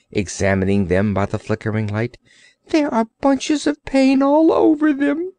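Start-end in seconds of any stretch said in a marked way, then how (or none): 2.7-5.3 high, whiny creature voice; self-pitying Orc. Acted. Orc pitying himself to the sailor, high whine. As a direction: moan about suffering as the Orc.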